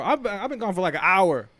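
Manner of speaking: 3 half-shouting at grandpa, "you're kidding me"; 1 exasperated outburst. Retold or imitated. Retelling his outburst at grandpa.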